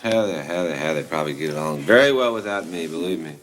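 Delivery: low, bitter self-dismissal; despondent dismissal. Acted. Tom telling Mom he isn't needed, despondent. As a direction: drop low and give up on yourself.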